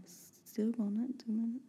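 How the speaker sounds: soft, mumbled tail of a rant; mumbling. Real, not acted. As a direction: let the words mumble away quietly. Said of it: "she be mumbling though".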